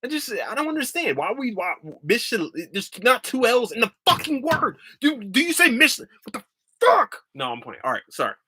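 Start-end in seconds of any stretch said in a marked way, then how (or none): 0.1-7.2 high-pitched outrage building to a yell; hammed-up indignation, climbing higher and louder; mock exasperated rant. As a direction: playfully lose it, ending in a squealed shout. Mock rant over a spelling.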